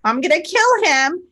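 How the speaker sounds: high, mock-horrified mom; fake mock panic. Daughter imitating mom's fake alarm, high and mock-horrified. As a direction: play the mother's fake alarm, half joking. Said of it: "doing that fake sort of".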